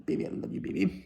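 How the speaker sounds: mock couple cooing in a whisper; mushy whisper. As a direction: murmur it like a lovesick sap. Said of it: "But some people are like".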